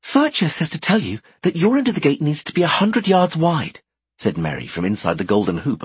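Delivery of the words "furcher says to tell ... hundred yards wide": put-on higher, brighter girl voice; young woman voice. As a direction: play Merry, lively. Merry's voice.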